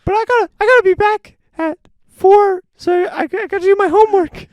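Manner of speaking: put-on very high, loud kid voice; squeaky child making hurried excuses; nine-year-old action hero. Host as the child star with homework, loud squeaky kid. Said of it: "Little nine-year-old".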